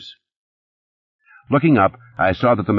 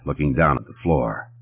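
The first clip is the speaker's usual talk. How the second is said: ordinary delivery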